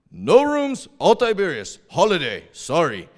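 mock blunt clerk voice; imitated hotel clerk. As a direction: mimic an insistent local clerk. Retold hotel clerk refuses them.

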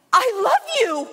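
imitating a high, joyful outburst; overjoyed imitation. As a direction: retell it as a high, elated cry. Retold joyful thanks after prayer.

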